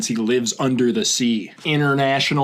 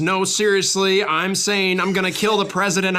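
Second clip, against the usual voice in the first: higher horse-race commentary delivery; Derby race announcer; 5 semitones higher, 4 dB louder than usual. Acted. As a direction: reel off the horse's name like a race caller. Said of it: "the announcers of the Kentucky Derby".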